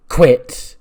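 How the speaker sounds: one word barked out loud and high; angry shout. Retold or imitated. Chris shouting at Ham not to quit, loud and angry. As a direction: slam the word down like an order.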